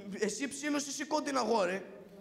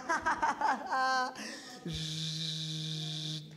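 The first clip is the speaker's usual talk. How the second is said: going crazy with laughter; roaring laughter; 7 semitones lower than usual. Acted. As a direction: laugh as if you're losing your mind. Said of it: "Θα μουρλαθώ στα γέλια".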